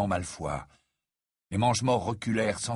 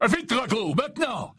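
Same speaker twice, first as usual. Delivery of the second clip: 1 angry urging; 12 semitones higher than usual. A narrator's reading. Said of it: "dit avec colère".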